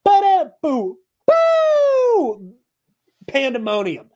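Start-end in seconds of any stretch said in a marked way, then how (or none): 0.0-2.5 high, loud sung-out riff hit; imitated horn blast. Fan reenacting the concert moment, loud imitated blast. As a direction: belt a long, high "boo" like a horn.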